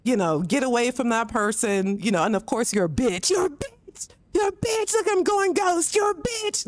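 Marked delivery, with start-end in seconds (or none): 3.3-6.7 much higher, sing-song jeering; high mock insult; mocking taunt. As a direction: needle the victim like a smug player. Imitating PvP players' taunts.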